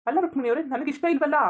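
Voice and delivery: loud, high, exasperated no; exasperated outburst. Acted. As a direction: finally snap back at her. Exasperated refusal of her proposal.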